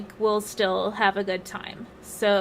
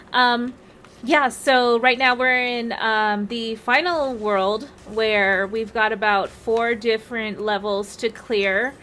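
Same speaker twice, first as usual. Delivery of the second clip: ordinary talk, nothing marked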